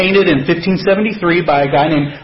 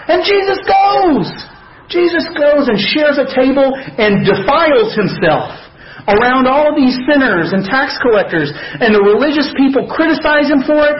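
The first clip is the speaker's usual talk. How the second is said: excited; 8 semitones higher than usual. Acted. Preacher builds to emphatic point.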